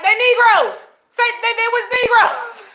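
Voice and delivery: loud, high exasperated yell; yelling at the radio. Real, not acted. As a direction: shout your point at the radio. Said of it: "you over here yelling".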